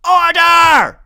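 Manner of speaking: very high, loud commanding shout. A narrator's reading. Freak on his desk silencing the class, very loud shout. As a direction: bellow for order like a judge. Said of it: "he shouts".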